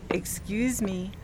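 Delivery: embarrassed slow excuse me; slow, soft apology. Retold or imitated. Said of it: "and I keep saying".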